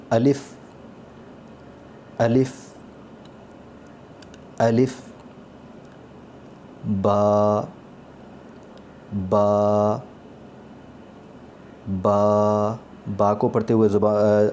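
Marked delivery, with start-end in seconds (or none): 0.1-4.9 الف said drawn out and clear; Arabic letter demo. Shown as a demonstration. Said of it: "عربی زبان کے پڑھتے ہوئے". 7.0-12.8 با with the lips pressed firmly shut; Arabic ba. Shown as a demonstration. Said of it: "با پڑھتے ہیں".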